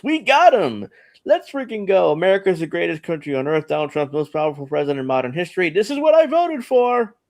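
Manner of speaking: excited, high-pitched mock cheering; gung-ho right-winger. Host reading a right-winger's post to co-hosts, mock-triumphant. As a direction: crow it like a fired-up patriot. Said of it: "he's a right-winger here".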